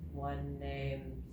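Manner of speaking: dragging slow motion voice; real slow. Shown as a demonstration. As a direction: stretch SLOW out long. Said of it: "Can you say it real slow?".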